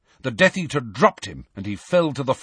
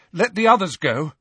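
higher, urgent plea; desperate; 7 semitones higher, 4 dB louder, faster than usual. A narrator's reading. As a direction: beg them to spare the others. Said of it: "said Harry desperately".

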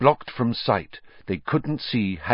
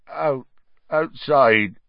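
croaking; 4 semitones higher, slower than usual. A narrator's reading. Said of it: "Hagrid croaked".

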